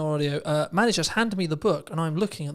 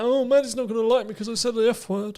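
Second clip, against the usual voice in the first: mock worried voice; 6 semitones higher, 4 dB louder than usual. Mocking an anxious person's thought.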